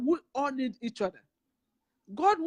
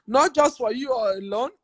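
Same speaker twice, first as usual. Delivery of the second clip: emphatic blessing; 2 semitones higher, 9 dB louder than usual. Real, not acted.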